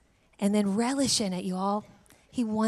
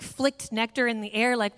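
ordinary delivery

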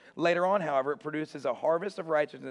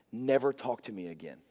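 lower, mock-serious cutting off; mildly offended, lowered voice; retold anger; 5 semitones lower than usual. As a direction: shut the friend down, deadpan. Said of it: "I was so mad at him".

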